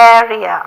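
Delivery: teacher corrects learners' "area". Shown as a demonstration. "area" சரியான ஆங்கில உச்சரிப்பு. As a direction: say "area" as English air-ee-uh, not Tamil ஏரியா. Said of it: "This is".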